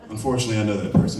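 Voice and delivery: ordinary talk, nothing marked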